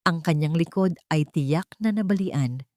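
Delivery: usual speaking voice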